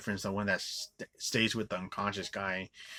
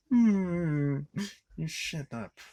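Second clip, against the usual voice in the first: mock high voice; 7 semitones higher, much slower than usual. Mocking the character's line.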